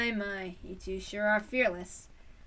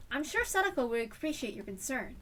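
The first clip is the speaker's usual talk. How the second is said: usual speaking voice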